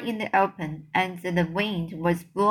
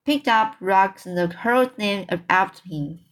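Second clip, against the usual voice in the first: ordinary delivery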